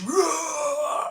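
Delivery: very high, strained crying whoa; shouting at the hedge. Retold or imitated. Retching into a hedge, a very high crying shout. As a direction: wail it into the hedge, sick and desperate. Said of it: "shouting at the hedge".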